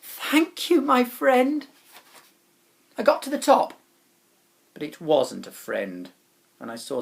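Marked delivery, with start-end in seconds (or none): 0.0-1.7 high, loud grateful call. A narrator's reading. Climbing up the rope, calling thanks upward loudly.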